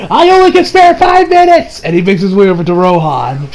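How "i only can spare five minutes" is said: hammed-up grudging concession, pitched way up; exasperated giving in. Koichi grudgingly agrees to help Rohan, hammed-up exasperation.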